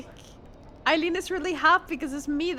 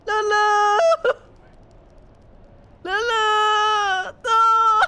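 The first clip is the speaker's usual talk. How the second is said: loud, high drawn-out wail to a pet; calling out; 5 semitones higher, 8 dB louder, much slower than usual. Real, not acted. Owner calls to the fallen cat, loud wail. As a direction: cry out in amused dismay.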